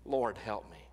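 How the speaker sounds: low pleading cry, "help me" almost whispered; soft desperate plea. Pretend. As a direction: beg the Lord quietly, breaking down to a whisper. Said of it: "where we cry out".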